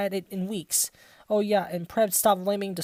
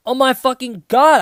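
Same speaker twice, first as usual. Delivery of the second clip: loud, higher hammed-up outburst; angry shout; 5 semitones higher, 9 dB louder than usual. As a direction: belt it out like a furious goth teen. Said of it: "I shouted angrily".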